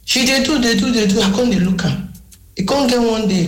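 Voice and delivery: ordinary delivery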